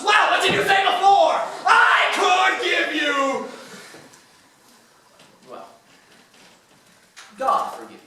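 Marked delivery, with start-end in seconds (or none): 0.0-3.5 angry shout. Acted. Mayor berates merchants.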